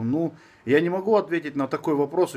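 normal talking voice

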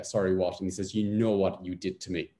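normal talking voice

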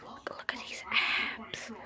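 slow hushed boast; whispered brag. Acted. Fighter showing off his abs to the ref, slow whisper. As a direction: whisper it like a secret flex.

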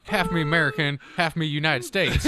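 put-on country accent. Amusing friends with outdoor tales, country accent. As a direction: spin a yarn in country accent. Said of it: "this here is a country accent".

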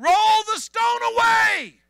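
high, loud, slow command; commanding shout. Acted. Jesus ordering the stone rolled away, loud command. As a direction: call it out to the tomb.

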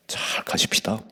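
soft unvoiced whisper; whispered plea. Real, not acted. Pastor whispering to the elderly fathers in the pews. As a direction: hush it as a gentle appeal.

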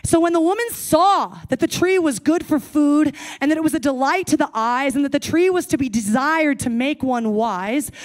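ordinary talk

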